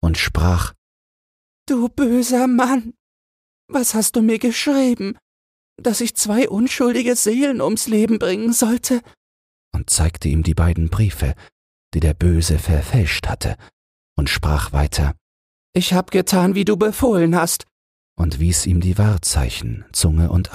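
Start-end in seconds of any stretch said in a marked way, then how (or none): 1.7-9.0 weeping reproach. A narrator's reading. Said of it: "Da fing die alte Mutter an zu weinen". 15.7-17.6 lightly voiced old mother, a bit higher; hint of an old woman speaking bitterly; the mother shows the king the proof, lightly voiced. A narrator's reading.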